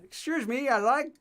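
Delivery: high, tentative-sounding imitation of his earlier self; high-pitched question rising at the end; imitated beginner's request. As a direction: put on a high voice to ask politely. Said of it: "something like that".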